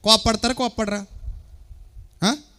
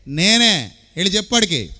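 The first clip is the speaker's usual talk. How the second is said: usual speaking voice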